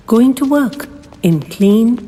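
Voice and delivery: ordinary delivery, nothing marked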